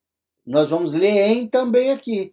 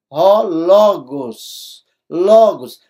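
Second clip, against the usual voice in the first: ró, logos stretched out, then logos louder; slow demo reading; 6 dB louder, much slower than usual. Shown as a demonstration. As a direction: sound out logos, then say it firmly. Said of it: "eu vou mostrar a palavra logos".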